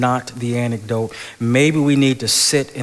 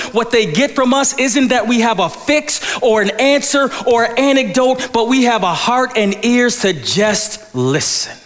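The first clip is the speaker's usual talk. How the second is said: much higher and louder, rising sermon cadence; full-force preaching; 12 semitones higher, 6 dB louder than usual. Acted. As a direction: preach it out to the whole church.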